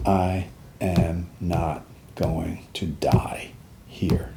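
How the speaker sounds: very slow, hushed and low; whispered vow. Retold or imitated. Soldier making a promise to himself, slow whispered vow.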